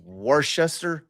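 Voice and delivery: Worcester drawn out slowly as wor-shester; slow, questioning Worcester as wor-shester; wrong guess. Shown as a demonstration.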